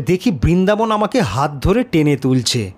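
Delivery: plain reading voice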